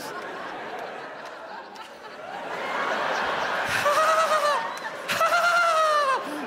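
very high shrieking giggle; hammed-up high giggling dad; stoned dad cackle. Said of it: "Here is exactly how my dad walks in the house".

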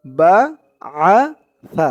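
بعث with a slow, soft ث, tongue tip at the teeth; soft tha. Shown as a demonstration. Teacher showing the soft tha sound. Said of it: "আইনের উচ্চারণটা গলার ভিতর থেকে".

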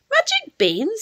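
put-on high questioning young Jack; wondering high-pitched question on BEANS; surprised boy. A narrator's reading. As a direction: query the beans in a high, amazed voice. Jack asks about the beans.

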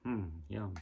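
ordinary delivery

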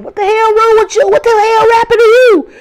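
high, loud alarmed yell; a brother shouting in shock at her face. Retold or imitated. Brother sees her swollen mouth.

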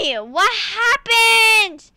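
high, loud, slow outraged shout; startled shout. Real, not acted. Gamer yelling at the game after a sudden death, outraged shout. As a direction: yell at the game in disbelief.

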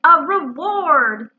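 loud, drawn-out, emphatic repeat. Acted. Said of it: "said Owl very loudly".

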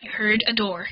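ordinary talk

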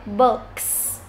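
"Books" with a short, lax U. Shown as a demonstration. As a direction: keep the vowel in "Books" brief. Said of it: "từ U ngắn này".

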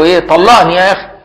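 lightly voiced wife, fed up and insisting; exasperated mock wife. Wife pressing husband again for divorce, mock exasperated.

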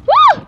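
high, loud "woo"; excited whoop. Real, not acted. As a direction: let out a piercing whoop. Celebrating in the game.

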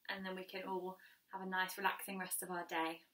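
ordinary talk, nothing marked

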